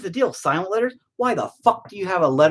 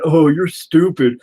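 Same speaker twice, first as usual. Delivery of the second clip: mock mocking voice; 3 semitones higher, 4 dB louder, slower than usual. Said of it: "everybody yells at me saying".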